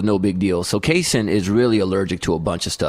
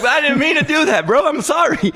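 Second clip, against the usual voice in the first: very high mock-desperate pleading; hammed-up wail of apology; 4 dB louder than usual. Dad thrown out by his wife, pleading apology.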